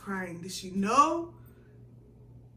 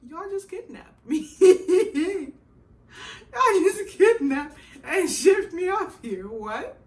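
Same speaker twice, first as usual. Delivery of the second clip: much higher, laughing and crying; laugh-crying protest; 11 semitones higher, 13 dB louder, slower than usual. Retold or imitated. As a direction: wail it through laughter and tears. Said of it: "I was like".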